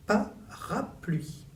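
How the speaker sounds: "parapluie" stretched out slowly, pa-ra-pluie; slow syllable by syllable. Shown as a demonstration. Teacher to pupil, slowly sounding out "parapluie". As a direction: draw out "parapluie" so each syllable is heard. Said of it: "par la syllabe pas".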